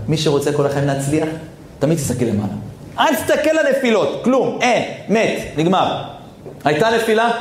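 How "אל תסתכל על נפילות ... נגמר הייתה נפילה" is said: urgent preaching. Real, not acted. Preacher urging not to look down.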